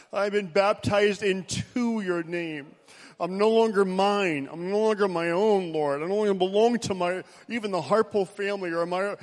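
earnest building prayer. Acted. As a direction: pray with rising fervour. Leads congregation in prayer.

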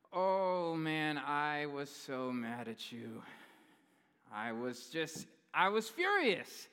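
loud sassy wife, furious at the end. Retold or imitated. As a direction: ham up the wife's sass. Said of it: "by the way, I'm adding sass".

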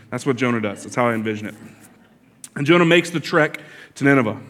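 ordinary talk, nothing marked

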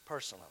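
"Personally" set apart by long pauses, low and soft; a pointed, hushed "Personally"; emphasis on Personally. Real, not acted. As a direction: drop "Personally" quietly on its own. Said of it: "I'm emphasizing the word personally".

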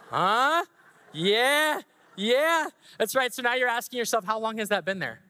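high, bubbling with glee over the pun; giddy pastor showing off the pun; excited. Real, not acted. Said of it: "I was so excited to do that".

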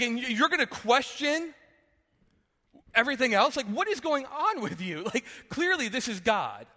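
scolding a stubborn friend in disbelief; exasperated onlooker. Retold or imitated. Us scolding Moses for arguing with a bush, incredulous. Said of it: "we're like".

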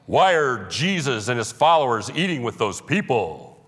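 put-on voice of a disapproving Pharisee; hammed-up Pharisee complaint; mock Pharisee voice. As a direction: act out an indignant religious snob. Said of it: "That's how I envision Pharisees to talk".

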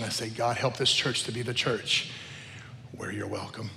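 low, soft plea to God; hushed, reverent asking; hushed prayer. Retold or imitated. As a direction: pray it quietly and low, with pauses. Continues the heartfelt prayer.

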